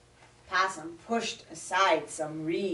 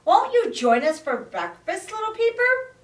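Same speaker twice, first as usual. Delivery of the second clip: friendly invite; 12 semitones higher, 5 dB louder, faster than usual. Acted. Possum inviting the frog.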